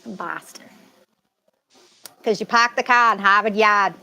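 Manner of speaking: exaggerated Boston accent with dropped R's; mock Boston local. Shown as a demonstration. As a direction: put on a thick Massachusetts accent. Playful demo of the Boston accent.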